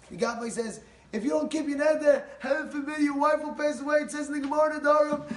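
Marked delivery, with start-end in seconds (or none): 1.1-5.3 high, loud warning; heated admonishing voice; urgent scolding mussar. Retold or imitated. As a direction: lecture him hard, pressing every clause. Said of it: "starts giving him mussar, pumping him up".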